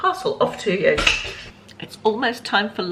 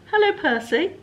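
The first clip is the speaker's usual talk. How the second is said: high, louder sing-song greeting; talking to a pet; 11 semitones higher, 4 dB louder than usual. Shown as a demonstration. Vlogger greets the toy cat in a pet voice. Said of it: "if you say something like".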